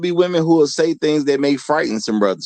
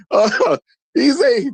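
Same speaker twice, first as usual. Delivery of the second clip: high and slow through laughter; laughing; 11 semitones higher, slower than usual. Real, not acted. Host rereading a chat joke, still laughing. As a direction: get the words out while still laughing.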